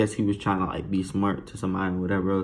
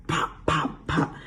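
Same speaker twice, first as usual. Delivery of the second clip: sharp high mouthed pops; punchy little pop, pop, pop; popping noises; 7 semitones higher, 3 dB quieter, much slower than usual. Retold or imitated. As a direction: imitate balloons bursting. Imitates water balloons bursting.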